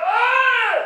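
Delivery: kraftigt, højt brøl; brøl det ud; demonstreret råb. Shown as a demonstration. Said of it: "Og så råber han". (højt demonstrationsbrøl)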